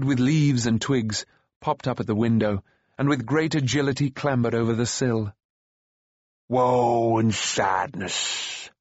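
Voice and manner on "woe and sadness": slow, drawn-out creature lament; creature's wail. A narrator's reading. Gurgi laments to Taran, wailing. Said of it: "the creature wailed".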